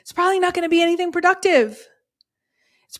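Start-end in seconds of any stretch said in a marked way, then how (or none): 0.0-1.9 high, quick and a little louder; high-pitched voice. Shown as a demonstration. Coach redoing her warning after joking about her voice, high-pitched. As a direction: say it again pitched right up. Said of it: "like Ross Geller on Friends".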